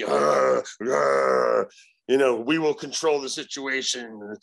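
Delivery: growling demon voice, unlike his own. Retold or imitated. Demon speaking through the possessed guy, growling voice. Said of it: "he would go back and forth".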